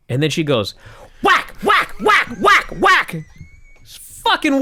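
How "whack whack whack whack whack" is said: comic shouted whacks, much higher; mock whack yells. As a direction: yell each whack like a stick landing on a head. Acting out sister's stick blows.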